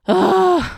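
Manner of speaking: long, high sigh of dread, real; heavy sigh. Real, not acted. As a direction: sigh before the hard part. Dreading the topic ahead.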